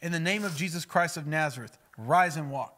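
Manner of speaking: loud, authoritative healing command. Acted. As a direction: order him up with full authority. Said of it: "they would command".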